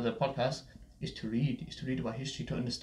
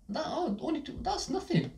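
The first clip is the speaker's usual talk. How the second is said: mock ad voice. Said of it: "They say".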